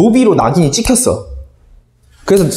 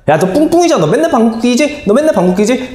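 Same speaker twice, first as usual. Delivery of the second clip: mocking classmates; 8 semitones higher than usual. Classmates taunt.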